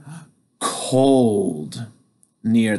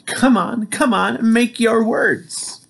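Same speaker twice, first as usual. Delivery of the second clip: eager urging; 10 semitones higher than usual. Acted. Firefly urging Sam eagerly.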